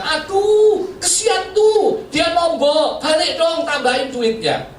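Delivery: high, fond wife's voice, slow at first; wife pleading for the shopkeeper, high and gentle. Retold or imitated. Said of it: "Dia katakan".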